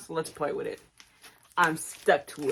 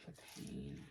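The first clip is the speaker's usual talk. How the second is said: mock whisper; 21 dB quieter than usual. After being sprayed.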